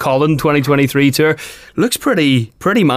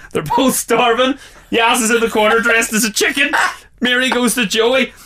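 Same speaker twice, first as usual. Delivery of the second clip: ordinary talk, nothing marked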